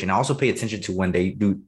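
usual speaking voice

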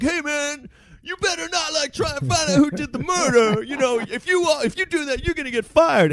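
high strained lieutenant yell at no real volume; mock yelling boss. Lieutenant scolding the cop investigating his friend's murder, mock yelling. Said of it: "like, yelling at".

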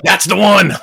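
high, loud mock eureka; triumphant. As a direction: announce it like you found gold. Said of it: "the one that I was like".

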